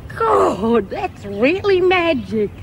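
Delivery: high, slow wonder; thrilled. Acted. Prisoner admiring the photo, thrilled. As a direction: stretch it out in delight.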